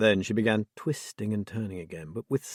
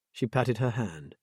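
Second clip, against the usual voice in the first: ordinary delivery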